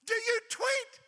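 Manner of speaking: put-on high loud chorus of teens; crowd shout. Young crowd shouting at the preacher. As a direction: call it out like thousands at once. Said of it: "said almost in unison".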